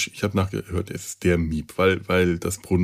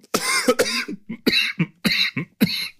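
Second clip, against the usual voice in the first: sudden coughing fit from choking. Real, not acted. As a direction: splutter and cough, caught in the throat. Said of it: "ich hab mich total verschluckt".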